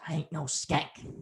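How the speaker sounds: quick, hushed mock protest; retold indignant protest. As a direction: imitate her, softly objecting. Retold addict's protest to insult.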